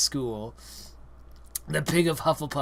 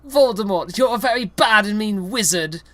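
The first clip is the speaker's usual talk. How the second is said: higher, louder, mock-savage; 8 semitones higher, 8 dB louder than usual. Reader voices Harry insulting Voldemort, mock savage. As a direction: hurl it at him like a kid insult. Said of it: "Harry savagely said".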